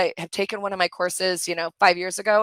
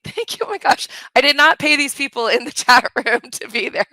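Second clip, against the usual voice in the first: higher and louder, laughing through the words; delighted laughing outburst; 4 semitones higher, 8 dB louder than usual. Real, not acted. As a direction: burst out with real delight at the kind comments. Thrilled by kind chat comments.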